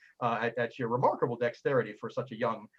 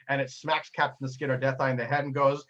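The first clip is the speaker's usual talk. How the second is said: ordinary talk, nothing marked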